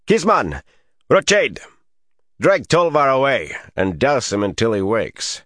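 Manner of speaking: high, commanding call across the yard. A narrator's reading. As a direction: call out orders, names first, high and strong. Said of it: "he raised his voice".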